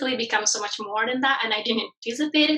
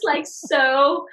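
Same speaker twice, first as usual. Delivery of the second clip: usual speaking voice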